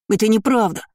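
put-on high, whining childish protest; sobbing denial. A narrator's reading. Boy denies hurting Kevin, sobbing. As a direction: deny it in tearful desperation. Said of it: "зарыдал Лайер".

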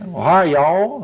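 slow, drawn-out Southern "hi, y'all"; southern accent. Retold or imitated. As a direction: lightly imitate a Southern greeting, slowly.

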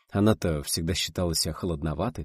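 plain reading voice

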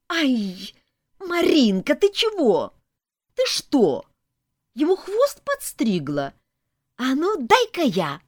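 put-on high, loud, startled kid's voice; excited child. A narrator's reading. Child finds Marinka cut the tail, excited shouting. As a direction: exclaim like a kid catching mischief.

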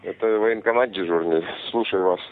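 ordinary delivery

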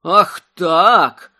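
loud, indignant outburst; loud exclamation. A narrator's reading. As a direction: exclaim it as a challenge taken up. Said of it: "вслух воскликнул Огнияр". (громкое возмущённое восклицание)

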